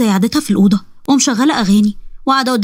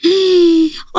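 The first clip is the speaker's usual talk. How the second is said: high, drawn-in gasp of wonder; delighted gasp; 6 semitones higher than usual. Acted. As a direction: gasp in thrilled amazement. Girl meets the ifrit at last.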